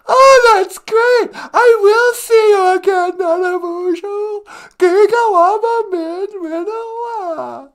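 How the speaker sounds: very high, loud, drawn-out squeal; thrilled sidekick character. Acted. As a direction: gush like an overjoyed puppet pupil.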